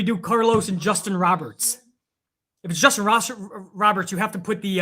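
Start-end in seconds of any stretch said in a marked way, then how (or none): none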